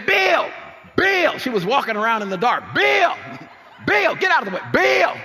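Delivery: high, loud calls of "Bill" over the music; mama calling out. Retold or imitated. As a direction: call your son across a packed basement. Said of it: "my mama's voice saying".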